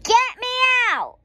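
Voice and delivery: high, loud squirming yell; little girl yelling from the cart; child's shout. A narrator's reading. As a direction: holler to be let out. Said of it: "I shouted".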